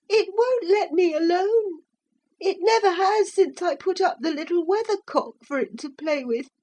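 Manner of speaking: put-on high tearful boy voice; crying little boy. A narrator's reading. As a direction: sob it out as a scared child. Frightened boy explains.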